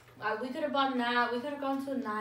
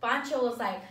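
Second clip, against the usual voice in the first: normal talking voice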